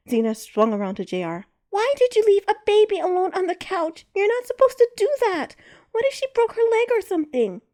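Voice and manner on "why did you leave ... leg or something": put-on high, loud indignant child voice; a little girl lecturing the adult; scolding child. A narrator's reading.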